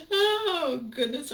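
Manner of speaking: high, loud laughing gasp; cracking up at a friend's joke; laughing cry. Real, not acted. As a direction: burst out laughing.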